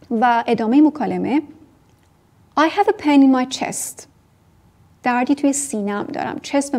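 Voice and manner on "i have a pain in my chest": slow model sentence. Shown as a demonstration. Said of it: "model sentence".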